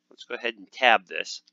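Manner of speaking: ordinary talk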